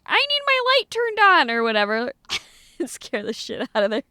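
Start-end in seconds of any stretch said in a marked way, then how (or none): 0.1-1.5 high squeaky goose. Acted. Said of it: "they'd be like".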